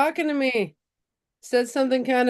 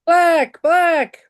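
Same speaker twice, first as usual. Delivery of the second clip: excited; 5 semitones higher, 8 dB louder than usual. Real, not acted. Eager pick of card color.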